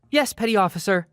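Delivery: high, bright mocking repeat; sassy singsong. Laundry girl answering the officer, high singsong sass. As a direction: sing it back to him cheekily.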